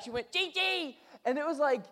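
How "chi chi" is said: put-on high-pitched toddler voice calling back; imitating little daughter. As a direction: mimic a small girl answering from another room. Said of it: "I heard her immediately answer me back".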